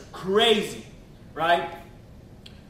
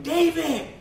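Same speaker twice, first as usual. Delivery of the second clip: higher, shouted call of a name; friend calling out; 7 semitones higher than usual. Retold or imitated. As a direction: call for help across the street. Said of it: "I hear my homeboy say".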